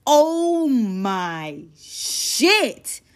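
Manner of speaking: very slow, stretched disbelief; stunned drawn-out gasp. Acted. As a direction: let it drag out in shock. Stunned at trashed house.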